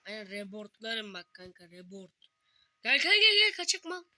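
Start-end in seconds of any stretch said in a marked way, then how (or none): 2.8-4.0 high, loud shout to a friend; calling out loud. Real, not acted. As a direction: yell him over, high and loud. Calling friend over in game. (yüksek sesle bağırarak çağırma)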